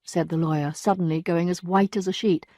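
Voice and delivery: ordinary delivery, nothing marked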